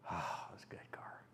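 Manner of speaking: breathy whispered afterthought. Real, not acted. Fondly remembering his old car, whispered. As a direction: let it fall to a soft whisper.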